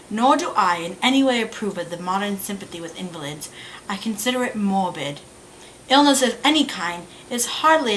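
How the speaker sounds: put-on British accent of an English lady in a play. Lady Bracknell lecturing Algernon about Mister Bunbury, British accent. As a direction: read it as a stage monologue in a British accent. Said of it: "about to pull out the British accent".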